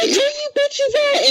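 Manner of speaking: very high, drawn-out mock demand; hammed-up high holler; mock grumpy yell. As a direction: imitate a cranky friend calling out, stretched high. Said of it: "woke up ... on the wrong side".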